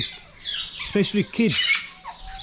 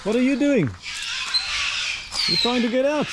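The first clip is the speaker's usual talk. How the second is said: cooing at a bird; 8 semitones higher than usual. Real, not acted. Talking to a bird.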